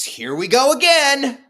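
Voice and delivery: loud, high, drawn-out exclamation; here-we-go-again cry. Acted. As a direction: call it out high and slow, stretching the last word. Voices dread as inning unravels.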